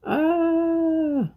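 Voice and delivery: a sudden high pained yelp. Real, not acted. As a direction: cook yelps as the knife nearly gets her finger, high cry. After almost cutting finger, cry out.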